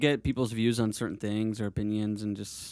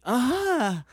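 high, held "ah" of realization; drawn-out "ah"; 12 semitones higher, 3 dB louder than usual. Acted. Visitor finally grasping what the local means, high drawn-out "ah". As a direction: let a high "ah" hang as it dawns.

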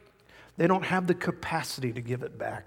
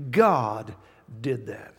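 ordinary talk, nothing marked

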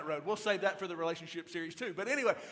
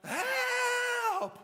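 high, drawn-out imitation of a panicked holler; hollering for help; 5 dB louder than usual. Guy behind in kayak hollers, imitated panicked yell. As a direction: holler it high and long, hammed up. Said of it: "he just hollers".

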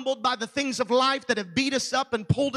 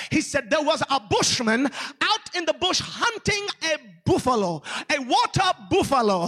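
put-on strong African accent; 2 semitones higher than usual. Retelling a missionary's bushman story to church, imitated African accent. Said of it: "with that strong African accent".

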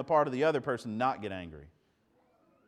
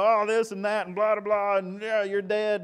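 a hint of a mock rant, a bit louder; ranting Saul; 5 semitones higher, 4 dB louder than usual. Preacher mimicking angry Saul to the church, mock rant. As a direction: rattle it off like a blowhard king. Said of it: "when he's angry, everything under the sun".

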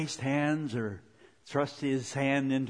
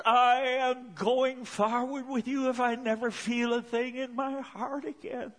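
high-pitched, impassioned pledge to God; fervent vow; 10 semitones higher than usual. Retold or imitated. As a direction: declare devotion with rising resolve.

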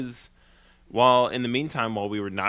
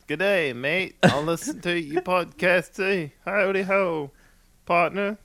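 cheery put-on Aussie neighbour, pitched up; mock Aussie accent; 6 semitones higher, slower than usual. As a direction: ham up a jokey Australian greeting.

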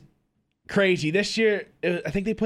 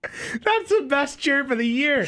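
laughing; 8 semitones higher, slower than usual. Real, not acted. Repeating the joke, laughing.

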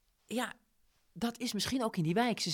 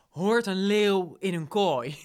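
slow, louder, weighty question; solemn wise man; 7 dB louder, slower than usual. Retold or imitated. Salas asks Ruiz a riddle, slow and weighty. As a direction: pose it like an old sage's riddle.

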